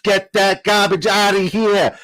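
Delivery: loud, high, slow New Yorker voice, stretching the words; New Yorker accent. Acted. Streamer tries his New Yorker accent on chat, loud, high and slow. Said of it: "how's my New Yorker?".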